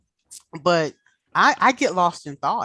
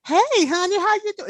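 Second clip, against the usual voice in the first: much higher, hammed-up Southern hello; Southern greeting; 13 semitones higher than usual. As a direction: sing it out like a sweet Southern lady. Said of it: "It's not even the Southern".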